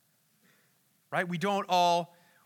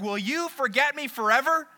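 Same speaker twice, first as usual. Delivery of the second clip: raised, pleading question; desperate question; 7 semitones higher, 4 dB louder than usual. Retold or imitated. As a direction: plead as if abandoned. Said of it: "some desperation there".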